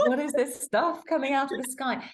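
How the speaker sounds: Steffi's co-host voices a bewildered sister seeing her first snow; mock bewilderment.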